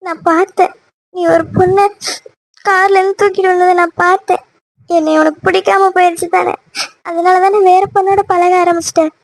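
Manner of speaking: high, crying accusation; tearful. Acted. Girlfriend who saw him carry another woman, tearful. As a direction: sob out the accusation, feeling cheated. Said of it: "எதுக்கு இப்படி அழுதுட்டு இருக்க".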